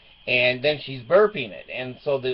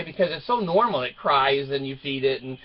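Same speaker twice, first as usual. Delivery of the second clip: usual speaking voice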